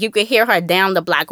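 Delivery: ordinary delivery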